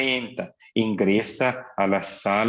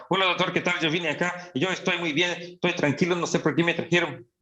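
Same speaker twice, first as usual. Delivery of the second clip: fast, high, pressured patient chatter; high and fast, words tumbling out nonstop; rushed patient talk; 6 semitones higher, faster than usual. Shown as a demonstration. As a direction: rattle it off like a manic patient. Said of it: "un paciente que te habla".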